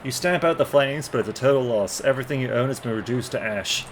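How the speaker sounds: normal talking voice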